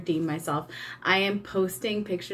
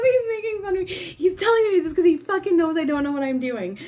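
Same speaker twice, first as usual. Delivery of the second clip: higher, louder, fast, tearful giggling; laughing complaint; 9 semitones higher, 4 dB louder, faster than usual. Real, not acted. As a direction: protest while still laughing. Laughing complaint about Eric.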